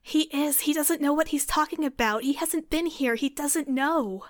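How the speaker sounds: fierce denial. Acted. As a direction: cut him off, refusing to accept it. Gale fiercely contradicts Alistair.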